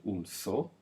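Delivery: un tho with a clear th at the start; teacher models zorro's z as th; Spanish th sound. Shown as a demonstration. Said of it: "a Z making the th sound".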